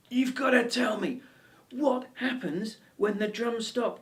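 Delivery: high, loud exasperated demand; acted frantic outburst, voice shooting up; losing rag. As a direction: shout it at the end of your tether. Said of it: "I just lost my rag".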